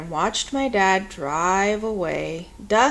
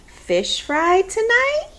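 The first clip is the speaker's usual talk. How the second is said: higher, slow, bright-eyed grandma question; upbeat old grandma asking; cheery grandma; 10 semitones higher, 4 dB louder, slower than usual. A narrator's reading. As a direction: tempt her with a treat. Grandma voiced, cheerful offer.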